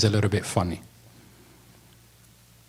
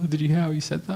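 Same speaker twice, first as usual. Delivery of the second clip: mocking laugh; 4 semitones higher, 6 dB quieter, faster than usual. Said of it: "we talk like that when our friends start saying".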